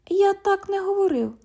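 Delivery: mocking mimicry. London mimics the cop's denial, high and mocking. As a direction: throw his words back high.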